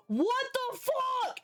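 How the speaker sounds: very high, slow, drawn-out imitation of the dog; voicing the panicked dog, high and stretched; high-pitched dog voice. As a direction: squeak it like a startled dog. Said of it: "he gets even higher pitched".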